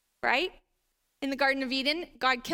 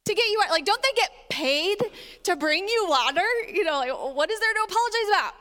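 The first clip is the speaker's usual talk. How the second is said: incredulous inner voice; 4 semitones higher, 3 dB louder than usual. Retold or imitated. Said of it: "You're sitting there thinking".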